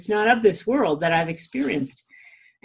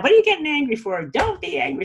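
imitating scolding parent; 7 semitones higher, faster than usual. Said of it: "The parents get mad".

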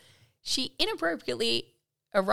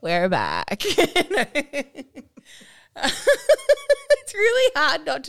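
high, loud giggling talk; can't stop laughing; 9 semitones higher, 7 dB louder than usual. Real, not acted. Collapse in laughter.

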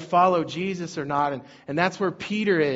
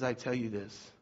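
lower, softer, hushed voice of Jesus; Jesus quietly blessing Peter; voicing Jesus; 6 semitones lower, 6 dB quieter than usual. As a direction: speak as Jesus would, low and gentle. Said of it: "And Jesus says".

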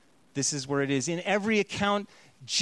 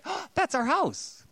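high, bright imitation of his wife's delight; lightly imitated wife's excitement; delighted discovery; 11 semitones higher than usual. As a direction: play her thrill at spotting the house. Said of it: "she was like".

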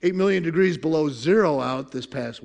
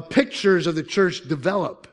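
normal talking voice

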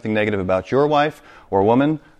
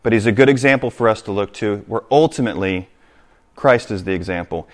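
ordinary talk

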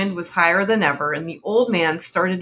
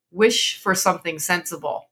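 ordinary delivery, nothing marked

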